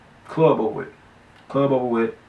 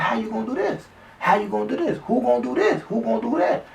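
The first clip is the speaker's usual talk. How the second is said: probing questions; 11 semitones higher than usual. Retold or imitated. Quoting himself probing the friend.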